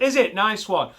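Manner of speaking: a keen relative taking the question; eager family member. Retold or imitated. Family member answers quiz host.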